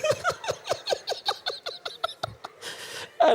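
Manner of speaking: long high airy laughter; high breathless laughter. Real, not acted. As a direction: give in to a laughing fit. Laughing at own joke.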